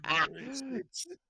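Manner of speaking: high, slow, sinister coaxing; a drawn-out Palpatine-style purr; dark side tempter. Acted. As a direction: tempt him like an evil emperor. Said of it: "to have been a Palpatine".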